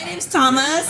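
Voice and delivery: high mock-American introduction; put-on American accent. Tourism worker introducing himself in put-on American accent. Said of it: "tourism workers".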